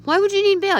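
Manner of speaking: high, loud, baffled question; incredulous. Retold or imitated. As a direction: demand an explanation in disbelief. Reacts to baffling bail note.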